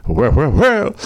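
crooner impression, lifted in pitch. [name] turns around to answer the cue, crooner impression. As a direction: answer as a famous crooner, playfully.

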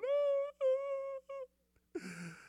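high strangled groan falling away; mock demonic moan; possessed groan. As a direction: imitate the man's wordless moaning.

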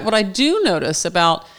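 ordinary talk

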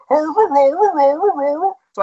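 slow, far-off Muppet chef gibberish; Swedish Chef impression. Announcer mocking the Swedish Chef comparison with his imitation. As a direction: mimic the chef's garbled sing-song, stretched out. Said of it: "and he talks like this".

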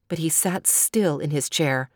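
ordinary delivery, nothing marked

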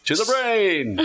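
booming, high and loud; dramatic echo. Acted. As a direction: announce it like a trailer. Dramatic echoed announcement.

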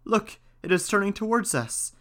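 high-pitched alarmed exclamation; exclaiming. A narrator's reading. Novice warns as kettle turns towards them, exclaiming. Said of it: "Then another exclaimed".

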